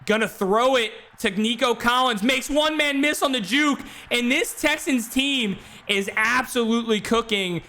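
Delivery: real sportscaster excitement; excited play-by-play. Real, not acted. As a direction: ride the big play as it unfolds. Calls a big play live.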